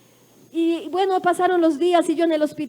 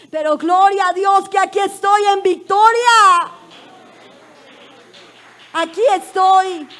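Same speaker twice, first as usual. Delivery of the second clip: loud, higher cry of victory; triumphant proclamation; 6 semitones higher, 10 dB louder than usual. Real, not acted. Triumphant climax of testimony.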